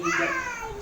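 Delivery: a daughter breaking down at the sight of her poor father; tearful cry. Acted. Daughter pleading with her poor father, tearful high cry. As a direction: voice jumps high in a sob on the first word. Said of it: "नानी बाई रोने लगी".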